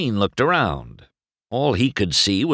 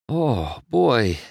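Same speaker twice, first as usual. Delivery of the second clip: mildly groggy waking sigh of words; a touch of just-woken bleariness; 4 semitones higher than usual. A narrator's reading. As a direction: say it as if barely awake. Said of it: "McQueen said groggily".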